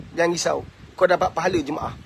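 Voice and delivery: ordinary delivery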